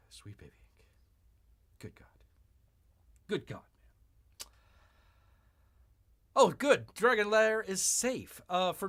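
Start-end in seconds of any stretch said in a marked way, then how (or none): 0.1-4.5 all the energy is gone; worn-out whisper. Real, not acted. Streamer too drained to go on about the company, whispered.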